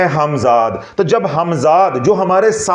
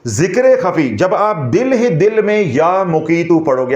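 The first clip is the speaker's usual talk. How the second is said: ordinary talk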